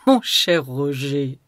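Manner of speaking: a touch of a teasing lady, slow; lightly voiced young woman, slow; voix de Gabrielle. A narrator's reading. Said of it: "dit-elle". (voix de femme taquine, lente)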